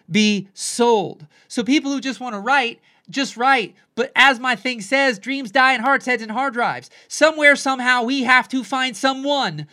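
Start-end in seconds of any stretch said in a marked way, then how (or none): none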